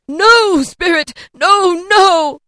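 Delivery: very high, loud frantic denial; desperate cry. A narrator's reading. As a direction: refuse the truth in terror. Scrooge horrified pleading.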